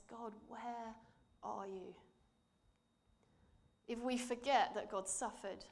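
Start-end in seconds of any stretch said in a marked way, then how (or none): 0.0-1.9 softer, hushed plea of pain; quiet suffering plea. Acted. Jesus in his suffering asking God, a soft hushed plea. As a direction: ask it softly, worn down by suffering. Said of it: "in his suffering says".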